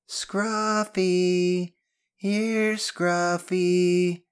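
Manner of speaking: very high, loud, drawn-out call; long bellow. Acted. Ben calls the imaginary dog one last time, bellowing. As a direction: holler for the dog so distant soldiers hear.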